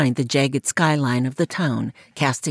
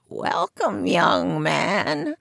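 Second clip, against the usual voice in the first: croaking fortune teller; much slower than usual. Acted. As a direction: croak it from behind a curtain. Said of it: "a voice croaked".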